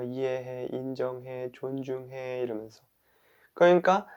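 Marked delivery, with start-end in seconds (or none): 0.0-2.4 very soft, even reassurance; understanding. Pretend. Speaker shows understanding toward oneself, softly reassuring. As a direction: murmur each word as quiet comfort. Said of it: "마음을 이해해 준 거예요".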